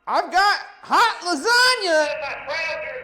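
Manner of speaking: loud, high, drawn-out passionate holler; passionate magic phrase. Pretend. Player delivers the silly magic phrase on request, passionate holler. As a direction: belt it out with over-the-top passion. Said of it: "deliver it with passion".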